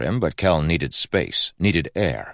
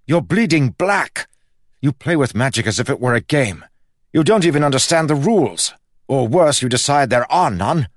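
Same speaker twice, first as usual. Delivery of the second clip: high, loud tirade building to a peak; loud furious rant climbing higher; rising anger; 11 semitones higher, 6 dB louder than usual. Acted. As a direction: tear into her, louder and louder. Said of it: "his voice rising".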